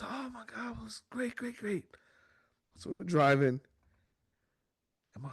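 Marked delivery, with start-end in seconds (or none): 0.0-1.8 high, soft, breathless gushing; mock wife swooning about the concert. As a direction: coo over how great the show was. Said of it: "She was".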